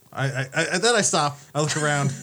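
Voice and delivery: ordinary talk, nothing marked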